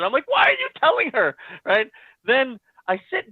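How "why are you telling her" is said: very high-pitched mock outcry; alarmed protest. He protests as Elwood texts his wife, alarmed. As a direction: squeak out a retold, exasperated question.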